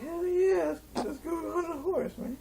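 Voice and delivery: high-pitched, excited mock cheer; eager agreement. Acted eager reply in story.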